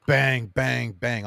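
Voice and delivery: loud, heavy knocks voiced as words; emphatic, spaced-out mock banging; imitated banging. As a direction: pound out each bang like a fist on a door. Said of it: "He goes to the next door".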